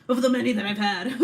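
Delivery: loud, quick aside through laughter; laughing. Real, not acted. Streamer jokes about her many therapists, laughing. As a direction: crack up at your own joke.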